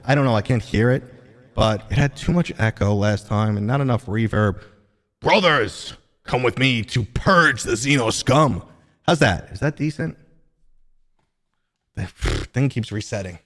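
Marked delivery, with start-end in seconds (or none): 5.2-8.6 slow, gruff battle-brother command; rally the squad like a grim warrior; Space Marine voice. Acted. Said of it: "doing my Space Marine voice".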